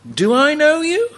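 Jesus asking. Acted. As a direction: ask it gently from above.

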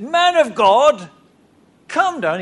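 high, loud, slow call. Retold or imitated. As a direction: call up to the man of God. Second soldier calls prophet.

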